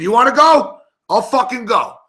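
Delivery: high, loud retold dare; fight challenge. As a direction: act out squaring up for a fight.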